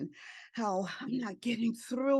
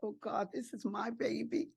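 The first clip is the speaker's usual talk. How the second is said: near tears; 3 semitones higher, 5 dB quieter than usual. Retold or imitated. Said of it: "I wanted to cry".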